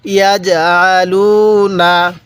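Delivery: slow Quranic recitation with long-held vowels; measured reciting, pauses between phrases; model recitation. Shown as a demonstration. Teacher leading learners to read along, slow recitation. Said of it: "শুরু থেকে একবার আমার সাথে সাথে পড়বেন".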